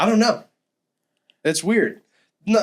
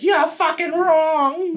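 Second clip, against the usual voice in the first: put-on high, drawn-out old-lady screech; slow, high-pitched mock protest; indignant old woman; 4 dB louder, much slower than usual. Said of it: "an old woman being like".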